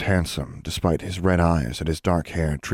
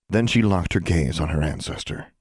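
usual speaking voice

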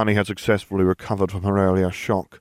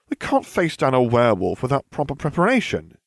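chiding; 6 semitones higher, 4 dB louder than usual. Acted. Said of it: "she chided".

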